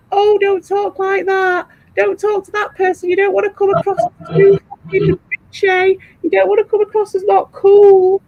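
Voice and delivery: high, sing-song mocking voice; mock fretting. Mocking someone's fussy advice in quote.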